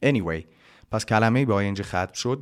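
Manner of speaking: ordinary delivery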